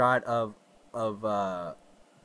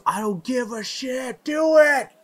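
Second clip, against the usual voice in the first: high, shouted order building louder; mock villain shout; 10 semitones higher, 6 dB louder than usual. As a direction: shout the command like a reckless warlord. Acts out villain ordering the barrage.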